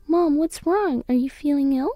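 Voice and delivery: put-on a little girl anxious over her mom; worried young girl. Daughter asking sick mom, worried child voice.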